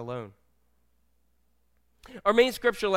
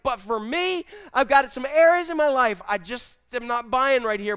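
raised, loud impassioned plea; earnest confession; 5 semitones higher, 5 dB louder than usual. Acted. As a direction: confess it urgently, high and forceful. Acting the father's earnest confession to Jesus.

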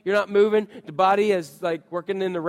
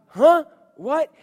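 high, loud "Huh?" then a mild "What?"; roused from a nap, confused; groggy startled wake-up; 9 semitones higher, 8 dB louder than usual. Acted. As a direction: sound jolted from sleep. Groggy Jesus woken.

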